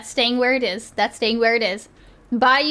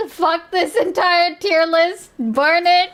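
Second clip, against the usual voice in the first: slow, higher, hammed-up fury at the list; mock outrage; 5 semitones higher, 3 dB louder, slower than usual. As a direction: pretend to rage and throw it away.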